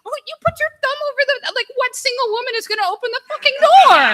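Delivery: very high, loud, fast mock exasperation; woman explains why she never opened the door; exasperated retort. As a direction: rattle off how obvious it was. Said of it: "And I'm like".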